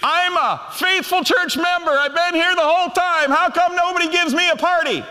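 high, loud, indignant whine; whiny church member. Retold or imitated. Older brother type demands his own party, high whining complaint. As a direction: complain like a sulking faithful member who was left out. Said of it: "You're like".

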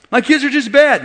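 mock exasperated gripe; exasperated complaint. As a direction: complain loudly, as if fed up. Acted parent complaint in retold quarrel.

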